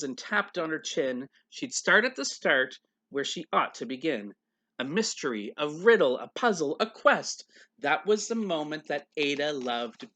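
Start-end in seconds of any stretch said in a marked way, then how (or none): none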